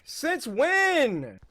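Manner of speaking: high but no longer shouted; incredulous complaint. Real, not acted. Gamer still baffled by the door, incredulous whine. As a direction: stretch it out in baffled protest. Repeats the disbelief, less loudly.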